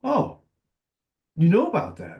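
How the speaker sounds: high surprised "Oh, you know about that?"; a client caught off guard, voice jumping up; retold surprise. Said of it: "And he goes".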